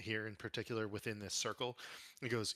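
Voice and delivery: ordinary delivery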